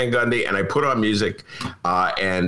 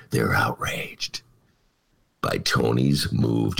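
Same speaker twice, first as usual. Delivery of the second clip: a breathless mock whisper; slower than usual. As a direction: hush it like a secret. Mocking the editorial writers.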